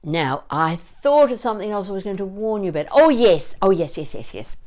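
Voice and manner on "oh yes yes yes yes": ordinary talk, nothing marked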